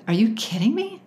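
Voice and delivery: retold confrontation, question rising in disbelief; standing up to him. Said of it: "standing up to him".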